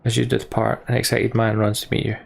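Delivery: normal talking voice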